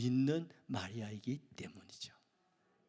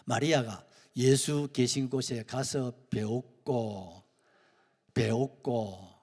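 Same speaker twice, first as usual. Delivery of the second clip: normal talking voice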